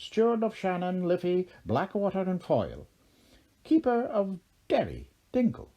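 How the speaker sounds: high, sly Irish leprechaun king. A narrator's reading. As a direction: grin through it like a cunning leprechaun. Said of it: "he said".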